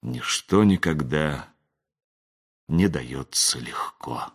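slow, low whisper fading out. A narrator's reading. Lone wizard on the stone looks into night, slow whisper. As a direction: whisper it slowly to yourself. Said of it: "прошептал".

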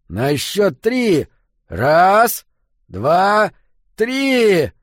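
high, loud, drawn-out count; shouted count. Acted. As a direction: shout each number, peaking on the last. Shouted count to ram door. (громкий счёт перед ударом)

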